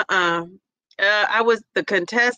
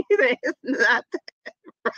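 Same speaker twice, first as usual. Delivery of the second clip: laughing; 2 semitones higher than usual. Real, not acted. Laughing while repeating.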